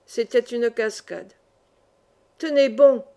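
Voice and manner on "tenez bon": short, louder urgent call; rallying shout to hold on. A narrator's reading. Uncle to climbers under the cascade, shouting. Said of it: "cria l'oncle Gaspard".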